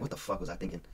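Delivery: low, soft half-awake groan of regret; drowsy, hushed morning regret; groggy regret. Retold or imitated. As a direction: mumble it like the alarm just woke you. Groggy at 4am alarm.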